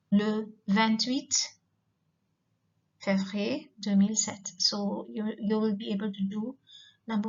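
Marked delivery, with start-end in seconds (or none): 0.1-4.5 "le vingt-huit" drawn out slowly; teacher modeling the date format; slow dictated date. Shown as a demonstration. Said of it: "The format is say".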